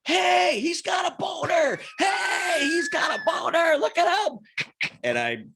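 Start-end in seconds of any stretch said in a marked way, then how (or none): 0.0-4.4 high-pitched drawn-out taunting shout; mock yelling. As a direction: holler it like friends publicly heckling a buddy. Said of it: "both of them just start yelling".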